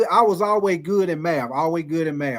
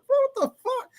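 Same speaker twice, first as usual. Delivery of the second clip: high, squeaky disbelief; a startled yelp of disbelief, acted out; retold shock. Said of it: "I said".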